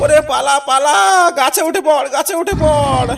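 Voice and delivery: high, loud warning cry to run; panicked shout. Acted. Father yelling at his son to climb a tree, panicked. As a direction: scream at him to flee up a tree.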